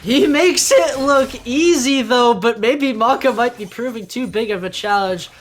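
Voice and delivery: high and loud, easing off toward the end; excited reaction. Real, not acted. Co-caster reacting to a close escape, loud and excited. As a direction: burst in loudly, then settle.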